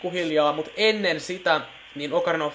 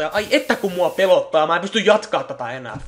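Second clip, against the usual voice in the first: sarcastic claim of being scared, louder; mock fear; 4 semitones higher, 7 dB louder than usual. Player mocking the creepy game, sarcastic fright. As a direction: overplay the fear for laughs.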